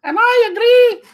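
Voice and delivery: put-on very high, drawn-out Italian-accented falsetto; hammed-up Italian accent in a sustained high pitch; Gabriella, Italian accent. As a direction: put on a silly squeaky Italian woman. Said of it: "I don't know why she's Italian".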